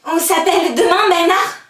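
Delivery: high, full shout into the distance; desperate calling out. Acted. Girl shouting after Bernard walking away, desperate calling. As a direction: yell after someone leaving.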